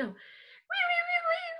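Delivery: high-pitched wiggly wees. Shown as a demonstration. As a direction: trace a squiggle with a high voice. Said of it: "We get to be all wiggly wiggly".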